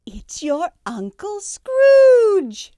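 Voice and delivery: high, loud, slow drawn-out announcement; triumphant shout. Acted. Sister shouting the riddle's answer at the party, triumphant. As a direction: crow the answer to the room.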